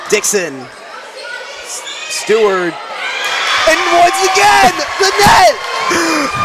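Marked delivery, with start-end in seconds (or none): 2.7-6.4 high, slow drawn-out shout; excited call. Real, not acted. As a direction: stretch it out like a big play. Another block, drawn-out call.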